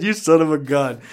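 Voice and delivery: real amusement, voice lifted and loud; amused. Real, not acted. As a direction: exclaim it on the tail of a big laugh. Repeats it after bursting into laughter.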